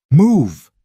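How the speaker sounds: loud sharp command; shouted order. Acted. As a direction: bark it, full volume. Fleeing, ordering daughter.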